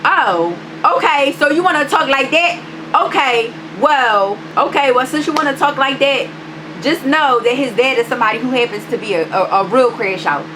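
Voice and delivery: high-pitched, challenging clapback; worked-up retold threat, pitched high; retold confrontation. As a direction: replay a warning to someone who crossed you. Confronting him in retold quarrel.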